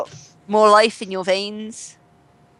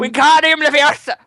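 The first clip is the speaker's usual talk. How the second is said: spell incantation; 6 semitones higher, 5 dB louder than usual. Pretend. Mock spell casting.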